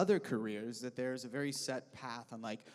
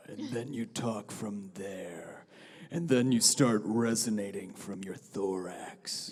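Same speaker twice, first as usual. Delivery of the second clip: lower, breathy whisper; low whispery demo; 7 semitones lower, 4 dB louder, slower than usual. Shown as a demonstration. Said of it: "lower the larynx".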